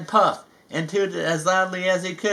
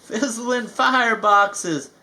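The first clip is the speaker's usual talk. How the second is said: mild gasp; 7 semitones higher, slower than usual. Acted. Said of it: "Gordon gasped".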